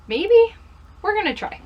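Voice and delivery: second-guess yourself out loud; doubtful maybe. Acted. Doubting her own resolution.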